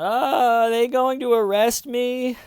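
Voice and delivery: squeaky high-pitched mock worry; a scared suspect's high, loud little question; high panicky imitation. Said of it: "Shannon's going".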